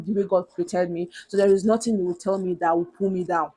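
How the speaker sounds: usual speaking voice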